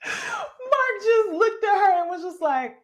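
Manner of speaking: high, cracking up mid-sentence; real laughter breaking the words; laughing. Real, not acted. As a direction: fight through the laughter.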